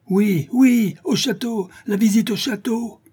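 very high, eager cries; crowd shouting. A narrator's reading. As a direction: cry out from all around as a mob. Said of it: "cria-t-on autour d'eux". (cris de la foule)

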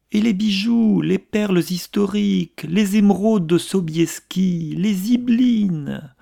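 building to high, loud rhapsody; rapturous listing. A narrator's reading. Marquise rhapsodizes over riches given up.

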